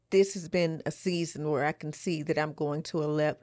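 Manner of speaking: ordinary talk, nothing marked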